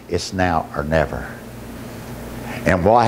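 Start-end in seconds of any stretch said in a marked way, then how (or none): 0.1-1.3 low, soft, grave line; inner prompting. Retold or imitated. Inner voice urging the father, inner prompting. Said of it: "something pricked me in the heart and said".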